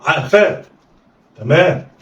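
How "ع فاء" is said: "ع فاء" joined in one quick go; snatch "ع فاء" with no pause; snatched together. Shown as a demonstration. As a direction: blend "ع فاء" like one word. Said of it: "يلا، اخطف".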